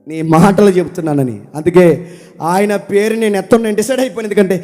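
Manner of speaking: loud, fervent pulpit lament; impassioned preaching. Acted. Preacher voicing Jeremiah's complaint to the Lord, impassioned preaching. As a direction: pour out Jeremiah's grief to the Lord at full force.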